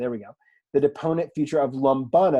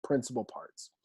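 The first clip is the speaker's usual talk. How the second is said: whispered; 2 semitones lower, 9 dB quieter than usual. Shown as a demonstration.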